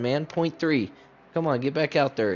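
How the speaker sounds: ordinary talk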